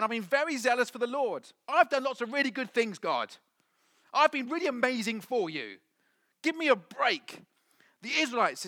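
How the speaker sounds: high, loud mock grievance; whining. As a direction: whine at God like a hard-done-by child. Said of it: "and he just whines".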